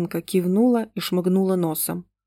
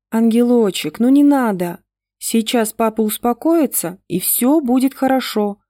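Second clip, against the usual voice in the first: ordinary delivery, nothing marked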